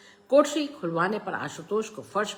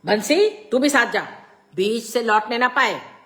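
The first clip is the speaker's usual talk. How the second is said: loud, raised-pitch order; stern command; 6 semitones higher, 10 dB louder than usual. A narrator's reading. Father ordering Bansi to escort the boy, stern command. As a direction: issue a forceful command.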